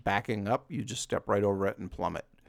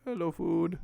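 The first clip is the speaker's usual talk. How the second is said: high-pitched sing-out to an animal; playful high greeting; 6 semitones higher than usual. Pretend. Player greeting chickens they hunt for food, playful high voice. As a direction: coo it way up high.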